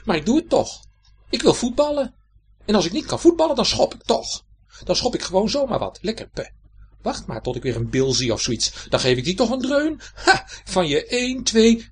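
high, loud burst of protest; outburst. Acted. The left shoe bursts out at the right shoe, loud and high. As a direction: explode at the sensible one. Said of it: "barstte de linkerschoen weer los".

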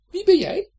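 very high, loud squeak; a flea piping up; tiny squeaky voice. A narrator's reading. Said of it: "een piepklein stemmetje piepte". (piepklein piepstemmetje)

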